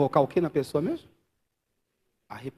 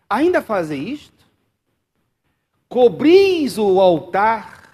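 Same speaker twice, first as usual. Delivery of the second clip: leitura lenta e mais alta, cada frase pesada; leitura bíblica solene; 6 semitones higher, 10 dB louder, slower than usual. Acted. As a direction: proclame o versículo devagar, com peso. Lê Malaquias solenemente. (pregador lê Malaquias à igreja, lento e solene)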